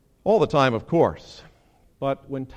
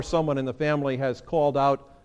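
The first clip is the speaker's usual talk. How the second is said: ordinary delivery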